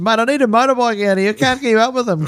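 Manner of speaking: high, breathless sideline reporter overwhelmed by the play; mock frantic plea. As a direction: sound like you're chasing tries end to end. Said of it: "I said".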